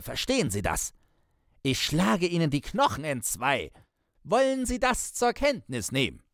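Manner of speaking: an angry, high-pitched telling-off; threatening. A narrator's reading. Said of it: "sagte es mit bedrohlich anschwellender Stimme".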